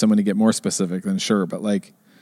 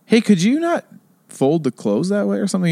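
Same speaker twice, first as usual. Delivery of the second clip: higher-pitched, careful home request; lightly re-enacted household request; polite request; 8 semitones higher than usual. Voicing own request at home.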